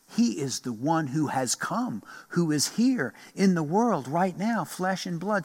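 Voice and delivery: ordinary talk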